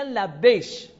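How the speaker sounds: لبیش ending in "sh" in place of k; Shanshana dialect. Shown as a demonstration. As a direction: say لبیش as labbeysh. (لبیش با شین به جای کاف)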